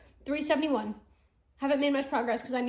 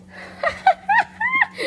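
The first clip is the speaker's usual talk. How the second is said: squealing laughter; high shriek of laughter; 6 dB louder than usual. Real, not acted. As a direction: crack up. Laughing hard.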